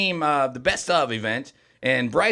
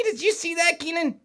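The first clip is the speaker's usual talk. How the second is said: high, amused disbelief; delighted, high-pitched surprise; surprised; 12 semitones higher than usual. Real, not acted. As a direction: squeal it in mock shock at the comment. Pointing out a flirty chat comment.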